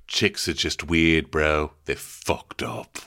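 low, slow dude voice; bro chef. Retold or imitated. Chef advising a friend about women, low and slow. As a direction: drawl it like a jaded bro. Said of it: "And the chef goes".

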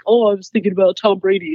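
lightly put-on husband voice; mock husband voice. Wife mimics husband's answer about his thoughts, mock husband voice. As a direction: imitate your husband with a goofy guy voice. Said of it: "that's how you do your husband's voice".

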